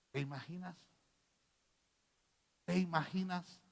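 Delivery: ordinary talk, nothing marked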